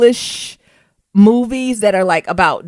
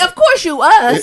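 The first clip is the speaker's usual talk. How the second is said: mock reassurance; 9 semitones higher, 4 dB louder than usual.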